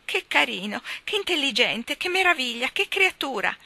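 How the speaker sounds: John mocking aunt Flossie's gushing praise; imitating aunt Flossie. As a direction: mimic a doting aunt cooing.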